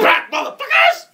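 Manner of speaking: very high, loud warning yell; over-the-top standoff holler; mock threatening shout. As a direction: shout them back as if waving a gun. Player brandishes gun in character.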